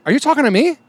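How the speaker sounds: high, pointed question back; mock standoff with a biker; retold challenge. Confronting the screaming biker.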